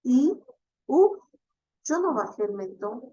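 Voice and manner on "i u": I and U high and loud, jaw kept still; closed vowels I U. Shown as a demonstration. Teacher contrasting closed vowels I and U. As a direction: keep the chin up on I and U. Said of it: "Pero si yo digo".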